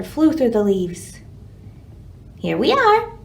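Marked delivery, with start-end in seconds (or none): 2.4-3.1 high, bright little bird arriving, louder; cheery woodpecker. Acted. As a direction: announce it like a proud little bird. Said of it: "said the woodpecker".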